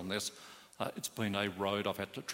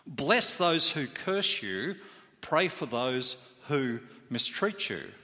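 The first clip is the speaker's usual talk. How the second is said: incredulous objection; 3 semitones higher, 4 dB louder, slower than usual. Retold or imitated. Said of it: "It's like".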